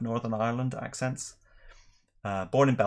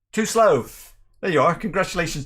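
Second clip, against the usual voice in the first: excited call-out; 7 semitones higher, 7 dB louder than usual. Real, not acted. Calling out a viewer's name.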